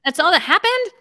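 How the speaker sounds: can't believe that was all; disbelieving surprise. Retold or imitated. Retelling her reaction to the simple web form, in disbelief. After holding breath clicking button.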